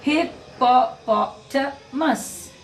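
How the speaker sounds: HIPPOPOTAMUS slowly in five separate beats; slow "Hippopotamus", one syllable at a time; syllable-by-syllable demo. Shown as a demonstration. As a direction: break "Hippopotamus" into slow, distinct pulses. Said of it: "I am going to say the words".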